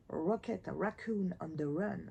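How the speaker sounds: reading the title in a lightly put-on English accent; a hint of an English accent on the title. Shown as a demonstration. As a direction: say it like an English speaker, slowly. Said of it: "mon accent anglais".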